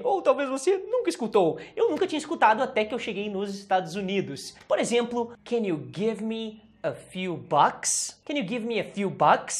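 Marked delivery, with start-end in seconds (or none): 5.4-8.2 very slow, spaced-out teacher demonstration; careful, drawn-out example question; slow model sentence. Shown as a demonstration. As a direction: give each word room for learners. Teacher models sentence slowly, then faster.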